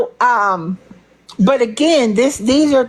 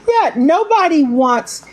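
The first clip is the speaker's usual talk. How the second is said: laughing; 6 semitones higher than usual. Real, not acted. Laughs after say it again.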